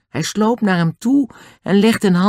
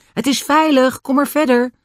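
calling out; 10 semitones higher than usual. Acted. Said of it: "Hij ging staan en schreeuwde".

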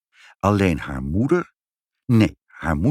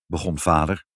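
ordinary delivery

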